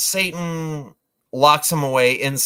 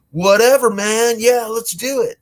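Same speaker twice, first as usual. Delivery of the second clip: high, loud laid-back dude; surfer dude voice; 8 semitones higher, 4 dB louder than usual. Retold or imitated. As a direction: toss it off like a stoner. Dudes answering in a stoner voice.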